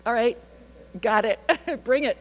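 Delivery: pumped-up, laughing acceptance; fired up. Retold or imitated. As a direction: rise to the dare, laughing. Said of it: "I'm like".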